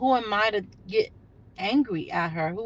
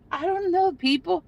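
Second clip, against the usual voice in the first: high, laughing through the words; laughing disbelief; 9 semitones higher than usual. Real, not acted. Laughing at the drivers with viewers, disbelieving. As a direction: laugh it off, shaking your head.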